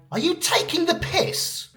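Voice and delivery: high, squeaky-pitched mock disbelief; incredulous. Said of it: "when something sounds ridiculous, unfair, or unbelievable".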